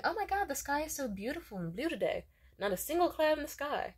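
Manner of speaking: high, wonderstruck small talk; cheerful gushing. Retold or imitated. Woman marvelling to her date at the blue sky, gushing.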